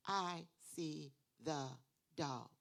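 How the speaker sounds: I see the dog very slow, one clap per word; clapped reading. Shown as a demonstration. Teacher modelling clapping out each word, slow. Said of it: "clap it out".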